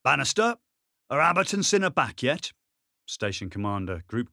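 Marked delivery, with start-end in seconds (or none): 0.0-2.5 loud, high bellowed call; bellowing. Acted. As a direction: bellow it across the yard. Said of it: "they heard a bellowing".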